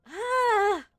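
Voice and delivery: long, high, loud cry of disbelief; exasperated ah. Retold or imitated. Gamer reacting to the villain's power, long high loud wail. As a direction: stretch out a frustrated wail. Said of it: "I'm like".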